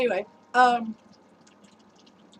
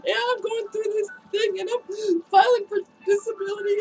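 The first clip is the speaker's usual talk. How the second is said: tearful, high and strained as she relives it; crying while retelling; 9 semitones higher, 3 dB louder than usual. Real, not acted. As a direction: voice breaking into real tears, pitched up.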